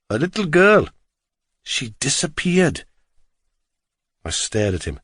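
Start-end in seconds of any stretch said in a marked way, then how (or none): 0.1-2.9 higher, singsong Welsh cabbie; Welsh accent. A narrator's reading. Driver starts revealing local tragedy, Welsh accent. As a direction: share local news in a Welsh lilt. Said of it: "his strong Welsh accent".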